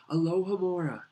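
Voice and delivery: ordinary delivery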